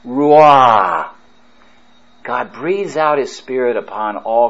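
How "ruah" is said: ruah as a long breathy rush of air, roo-ah; breathed Hebrew word. Shown as a demonstration. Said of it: "Just even making that sound".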